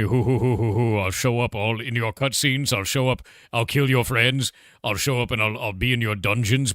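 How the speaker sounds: hammed-up villain cackling and boasting; mock Arthas villain. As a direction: tease like a cartoon baddie. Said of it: "and he's like".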